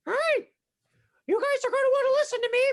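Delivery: high, loud witchy command; Space Witch voice. Acted. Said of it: "try the Space Witch voice".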